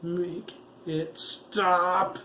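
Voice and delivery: high, very slow strained creature cry; half-transformed boy begging, a strained beastly cry; growled. A narrator's reading. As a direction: force it out like a beast in pain. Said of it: "He growled".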